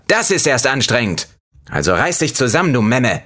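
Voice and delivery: half lemon taunts the narrator, high mocking voice. A narrator's reading.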